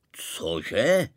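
stunned ruler's loud reaction; astonished. A narrator's reading. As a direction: blurt it out in disbelief. Maharaja reacts, astonished, to the proposal.

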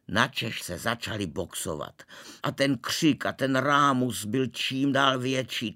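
usual speaking voice

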